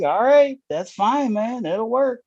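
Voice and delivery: high-pitched quoted reply; lightly acted, high-voiced agreement; retold agreement. Retelling own easygoing reply, pitched up.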